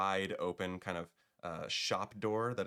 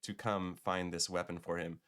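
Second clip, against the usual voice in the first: normal talking voice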